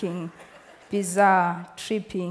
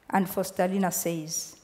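plain reading voice

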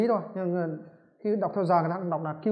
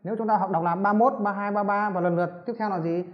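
ordinary delivery

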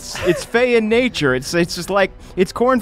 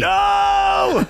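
shout; 14 semitones higher than usual. Acted. Character reacts to squished skin.

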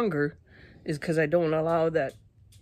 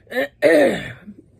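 real loud hard throat clearing; clearing pepper powder from the throat; 8 semitones higher, 8 dB louder than usual. Real, not acted. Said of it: "I'm clearing my throat so much".

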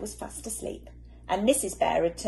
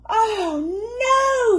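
high, loud near-crying "oh no"; almost in tears with tiredness; mock anguished cry; despairing wail; 10 dB louder than usual. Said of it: "said Mister Bear".

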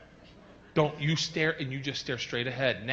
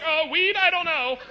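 high, loud, panicky mock stoner; nervous driver; 7 dB louder than usual. Comic, driver caught by cop, high panicky. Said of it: "And you're like".

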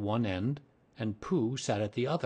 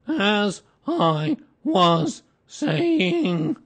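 very slow, booming, word-by-word reproof; loud and stern; 10 semitones higher, 11 dB louder, much slower than usual. A narrator's reading. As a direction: stretch each word out loudly to silence interrupters. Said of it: "said Eeyore loudly and sternly".

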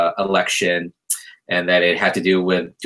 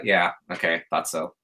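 smug; 3 semitones lower, 6 dB quieter than usual. Retold or imitated. Smug retold reply.

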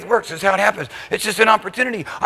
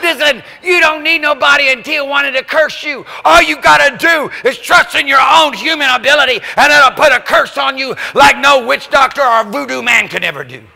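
emphatic preaching; 4 semitones higher, 5 dB louder than usual. Acted. Preaching at full force.

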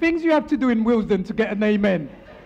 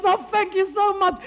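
higher, gushing relief; elated thanks; 8 semitones higher than usual. Acted. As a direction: thank them as an overjoyed owner. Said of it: "She's elated".

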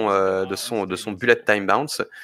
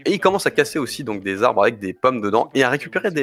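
ordinary delivery

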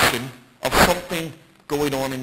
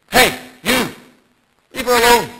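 louder, higher, aimed at one man; pointed rebuke; 7 semitones higher, 7 dB louder than usual. Retold or imitated. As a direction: call him out sharply by name. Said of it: "he's speaking to him".

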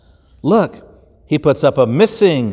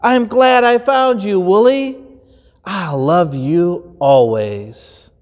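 higher, then slow and tender; tender shepherd; 5 semitones higher than usual. Acted. Shepherd telling his lamb he loves him, tender.

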